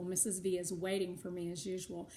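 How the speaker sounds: ordinary delivery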